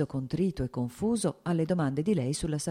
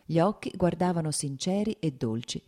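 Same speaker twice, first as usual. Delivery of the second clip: ordinary delivery, nothing marked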